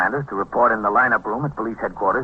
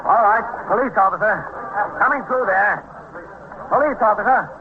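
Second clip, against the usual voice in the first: calling through crowd; 11 semitones higher than usual. Acted. Officer pushes through crowd.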